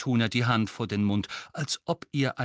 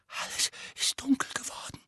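higher, whispered and hoarse. A narrator's reading. Dudley tells his parents, hoarse whisper. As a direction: barely voice it, shaken. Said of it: "sagte Dudley heiser".

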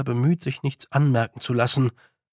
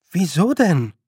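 higher, louder astonished question; wondering, pitched up; puzzled surprise; 5 semitones higher, 5 dB louder than usual. A narrator's reading. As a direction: ask in open bewilderment. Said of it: "fragte Tiffany verwundert".

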